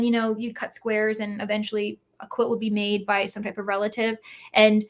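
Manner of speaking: ordinary talk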